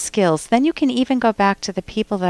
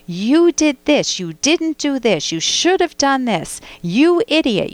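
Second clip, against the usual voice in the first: higher, slow accusations punched on "you"; aggressive blaming; 5 semitones higher, slower than usual. Shown as a demonstration. Host showing how blame escalates into name calling, accusatory. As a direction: scold as if jabbing a finger. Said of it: "It is aggressive language".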